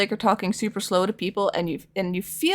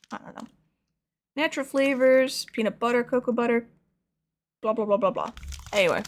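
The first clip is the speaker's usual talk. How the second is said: ordinary talk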